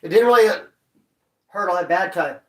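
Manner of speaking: normal talking voice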